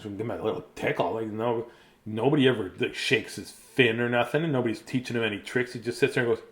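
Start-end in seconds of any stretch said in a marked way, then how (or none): none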